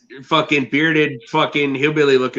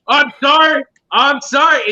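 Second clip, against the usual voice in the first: high-pitched, louder imitation of panicked apologizing; retold fearful apology; 11 semitones higher, 4 dB louder than usual. Buddy apologizing to customers he offended by accident, imitated panic. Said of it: "just drop with fear".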